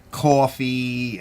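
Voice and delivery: drawn-out New York accent. Shown as a demonstration. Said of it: "I have that New York accent".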